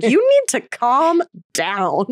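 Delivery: slow, stretched-out imitation of a boyfriend; mock-exasperated boyfriend, drawn out; imitated admonishment. Boyfriend's reply to her fretting.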